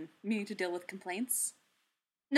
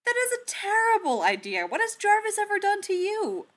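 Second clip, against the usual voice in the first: much higher and louder, outraged; indignant protest; 13 semitones higher, 7 dB louder than usual. Acted. Tony objecting to Steve's plan, high indignant outrage. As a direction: squawk it in disbelief.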